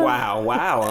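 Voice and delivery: sarcastic wow. Retold or imitated. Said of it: "sarcastic "wow".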